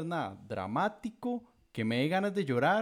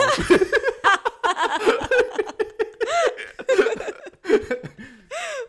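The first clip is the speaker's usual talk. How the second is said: big burst of laughter; loud high laughter; 11 dB louder than usual. Real, not acted. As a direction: laugh out hard.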